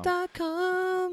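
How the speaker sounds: high, drawn-out dot com; playful echo. Pretend. Echoing the co-host's email address, high playful chime.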